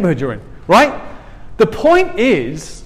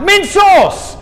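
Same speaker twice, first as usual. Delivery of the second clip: high, loud shout at the lambs; 12 semitones higher, 5 dB louder than usual. Retold or imitated. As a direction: bellow it across the field.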